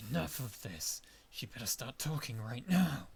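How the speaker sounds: loud, angry demand; angry outburst. Acted. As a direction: snap at the old woman to talk. Party member snaps at washwoman.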